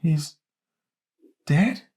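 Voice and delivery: a grandma falling apart in his arms; sobbing disbelief. A narrator's reading. Grandma sobbing into her grandson, high broken question. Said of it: "She sobbed and fell into him".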